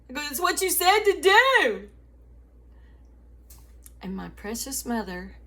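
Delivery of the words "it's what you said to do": much higher, loud, frantic, slowing on the last words; mock hysteria over the foam; retold panic. Telling mom the soap won't stop, in mock panic.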